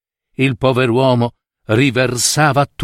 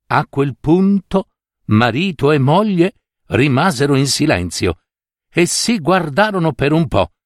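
usual speaking voice

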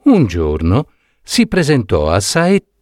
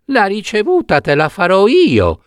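tiny voice, much higher, begging; thin little pleading voice; 9 semitones higher than usual. Acted. Trapped prince begging Saetta not to deliver him, tiny thin pleading voice. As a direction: plead from inside the box, small and thin. Voice in the box answers.

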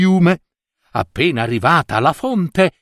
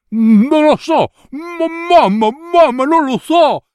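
put-on very high, tearful stammering girl; panicked wail; 13 semitones higher, 3 dB louder than usual. Cecilia spitting toads before her mother, tearful panic. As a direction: cry helplessly for mummy.

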